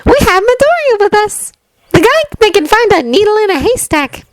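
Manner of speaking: put-on high, bright smiling girl. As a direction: say it with a smile. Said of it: "Uraraka says with a smile in her voice".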